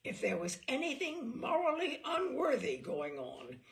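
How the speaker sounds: usual speaking voice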